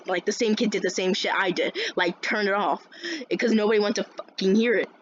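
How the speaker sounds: ordinary delivery, nothing marked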